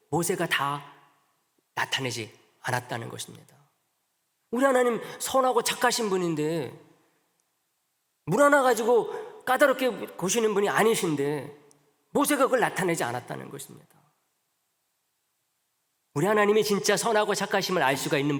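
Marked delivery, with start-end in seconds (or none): 4.5-14.0 ardent preaching. Acted. Preacher stresses God's goodness.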